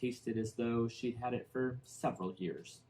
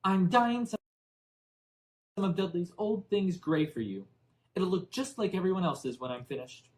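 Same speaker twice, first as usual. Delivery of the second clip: high and louder, prim; high loud prim aunt; stern aunt; 7 semitones higher, 4 dB louder than usual. A narrator's reading. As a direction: talk down to the boy. Aunt Petunia lecturing Harry.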